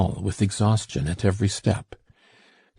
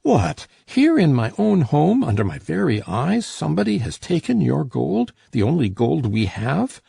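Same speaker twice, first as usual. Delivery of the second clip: rising crescendo; 7 semitones higher than usual. A narrator's reading. Miser raging at his daughter over the lost gold, building crescendo. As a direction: let the fury swell until it rings through the house. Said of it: "his voice going up in a crescendo".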